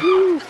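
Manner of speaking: short high-pitched woo; high whoop. Pretend. Comic welcomes a brother in the house, high whoop. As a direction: let out a playful whoop.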